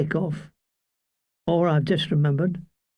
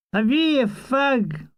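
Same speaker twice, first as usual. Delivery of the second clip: higher-pitched Scottish voice; Scottish accent; 6 semitones higher than usual. A narrator's reading. Soldier out of cigarettes begs one, Scottish accent. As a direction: cadge it in a Scots accent.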